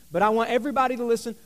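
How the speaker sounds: usual speaking voice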